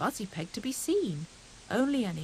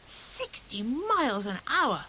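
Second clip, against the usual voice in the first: excited cry; 3 semitones higher, slower than usual. A narrator's reading. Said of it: "cried Hare".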